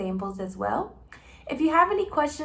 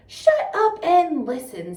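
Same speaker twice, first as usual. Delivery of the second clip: snapping at Bunce; 5 semitones higher, 7 dB louder, slower than usual. Acted. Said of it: "said Bean".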